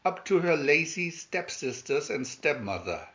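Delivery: ordinary delivery, nothing marked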